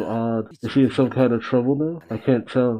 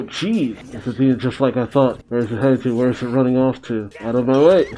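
ordinary talk

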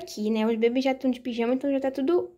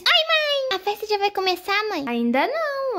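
put-on high kid asking mom; high little kid voice; child voice; 8 semitones higher than usual. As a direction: ask mommy like an eager toddler. Child doll asks mother.

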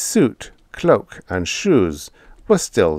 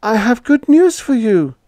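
much higher, louder, forced brightness; hurried cheer; 7 dB louder than usual. A narrator's reading. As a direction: rush the good news to distract him. Hurried landlady.

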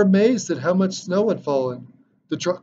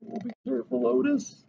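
higher, soft worried warning; gently fearful caution to the tractor; anxious warning; 7 semitones higher, 6 dB quieter, faster than usual. A narrator's reading. Narrator warns tractor.

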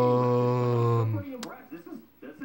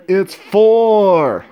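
big reveal; 5 semitones higher, 7 dB louder than usual. Pretend. Host reveals answer.

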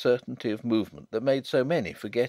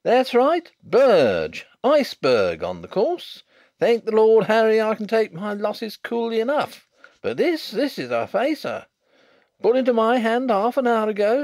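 much higher, louder indignant bluster; blustering bookmaker; 11 semitones higher, 8 dB louder than usual. Acted. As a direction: demand your safe like an outraged bookmaker.

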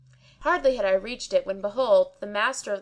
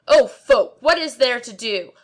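much louder, higher address; loud appeal; 3 semitones higher, 8 dB louder than usual. A narrator's reading. Kazi calling out to the crowd at his door. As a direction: call out to the crowd.

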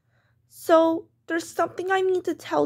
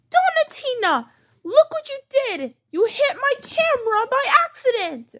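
high, upset reprimand; scolding mother; 7 semitones higher than usual. Acted. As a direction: exclaim at the child's mishap. Mom scolds child for hitting camera.

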